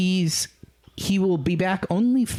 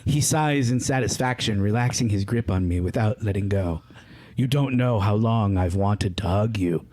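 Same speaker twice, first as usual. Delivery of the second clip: story narration; 6 semitones lower than usual. A narrator's reading. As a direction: read the romance passage lightly. Reads a fanfic passage aloud.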